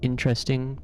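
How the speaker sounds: "Interesting" stressed on IN; first-syllable stress. Shown as a demonstration. Explaining the difference, "Interesting" stressed on IN. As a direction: say "Interesting" as IN-tress-ting. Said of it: "stresses the first one".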